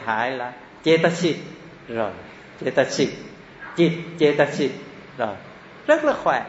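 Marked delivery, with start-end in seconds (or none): 5.9-6.4 amused exclamation. Real, not acted. Teacher exclaims about Thai ease.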